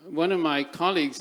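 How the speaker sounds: usual speaking voice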